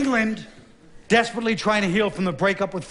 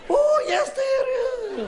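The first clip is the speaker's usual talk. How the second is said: way higher, a crazed shrieking cry; shrill mock shriek; much slower than usual. Mad queen shrieking at the English, high mock shriek.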